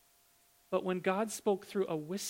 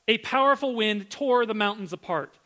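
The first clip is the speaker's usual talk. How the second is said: "A powerful wind tore the mountains apart" rising higher and louder in awe; amazed emphasis; 4 semitones higher, 6 dB louder than usual. Shown as a demonstration. Preacher rereading the verse to stun the congregation, excited. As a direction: hit "powerful" hard, astonished.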